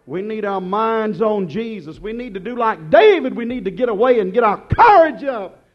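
ordinary delivery

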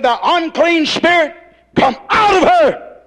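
loud, high, slow command to the spirit; commanding shout. Retold or imitated. Jesus commands the unclean spirit out, shouting. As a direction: cast it out with full force. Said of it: "there has to be a command".

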